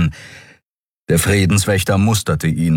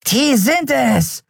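high, slow skeleton creature voice; drawn-out, high-pitched bizarre announcement; slower than usual. A narrator's reading. Skeleton points out the wanted pair, bizarre high voice. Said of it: "verkündete seine bizarre Stimme".

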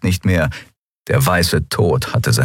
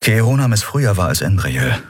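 plain reading voice

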